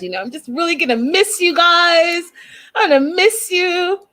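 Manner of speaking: high, whiny mock sob; mock crying. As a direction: imitate her tearful goodbye, hammed up. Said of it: "Darcy starts crying again".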